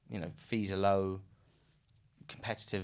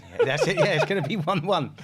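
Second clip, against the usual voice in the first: much higher and louder, laughing through it; 9 semitones higher, 11 dB louder than usual. Real, not acted. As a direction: crack up while repeating the punchline. Laughing at footballer's boast.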